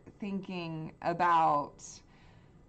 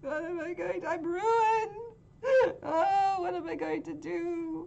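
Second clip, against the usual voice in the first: high, crying wail over a ruined set; sobbing; 9 semitones higher than usual. Acted. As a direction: sob through every word. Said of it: "starting to sob".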